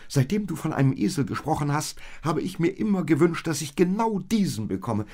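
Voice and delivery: high, loud, tumbling outburst of delight; overjoyed. A narrator's reading. Maria sees the donkey she always wished for, overjoyed.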